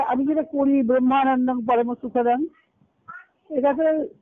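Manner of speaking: usual speaking voice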